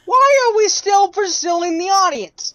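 exasperated shout. Real, not acted. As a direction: shout the question in high-pitched exasperation. Exasperated outburst at the game.

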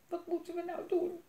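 high, small sobbing voice; a frightened girl sobbing in the dark; mild sob. A narrator's reading. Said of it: "snikte Elise".

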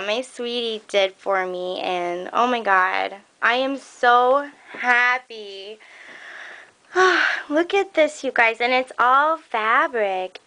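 ordinary talk

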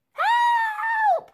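very high, loud scream; scream for help. A narrator's reading. Elsie screams as a bag is thrown over her head. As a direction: shriek as the bag comes down. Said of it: "she screamed".

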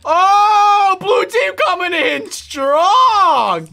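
loud, high, very slow announcement; shouting as his team storms in; hyped shout. Real, not acted. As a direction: call the charge like a hype man. Hyped charge at enemy team.

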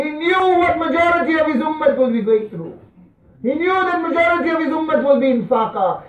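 urgent high-pitched sermon delivery; impassioned preaching. Acted. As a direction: send it ringing out over the congregation. Preaches at full force.

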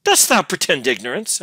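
loud, high accusing question; scowling. A narrator's reading. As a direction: challenge her angrily. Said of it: "scowling upon her".

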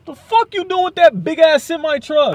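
sudden furious yell, high and loud; real outburst at a truck driver; real startled shout. Real, not acted. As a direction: bark it at a truck that nearly hit you.

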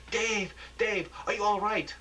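high frantic calls of his name; panicked calling. Acted. Anchor calls frantically to injured reporter Dave, high panic. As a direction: cry out to him in alarm.

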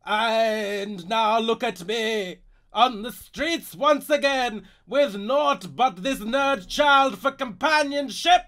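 slow, loud theatrical lament; tragic old actor. Acted. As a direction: mourn like a faded stage star.

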